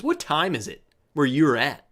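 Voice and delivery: usual speaking voice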